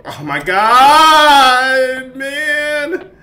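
high, loud, stretched-out groan of despair; exasperated wail. Real, not acted. Gamer stuck in a scary level, slow high wail. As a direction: drag it out, fed up and scared. Overwhelmed by the game.

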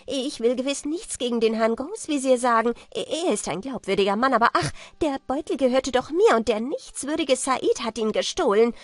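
loud, high, agitated protest; frantic pleading. A narrator's reading. Merchant defends his claim to the Caliph, frantically pleading. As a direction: stammer and plead insistently.

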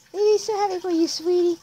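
high, loud gushing mother voice; doting mom. Acted. Mom congratulates child on first Pokemon, high doting voice. Voicing proud mother in game dialogue.

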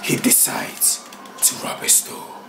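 hushed unvoiced whisper. Real, not acted. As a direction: whisper the scheme softly. Whispered narration of plan.

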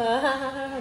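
quick burst of laughter; short, rapid ha-ha laugh; amused laugh. Real, not acted. Amused family watching the baby.

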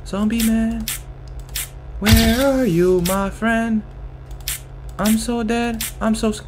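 anxious. Real, not acted. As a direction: call for him in rising fear. Panicked searching for zombie.

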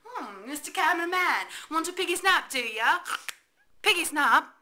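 loud taunting. Acted. Taunting the cameraman.

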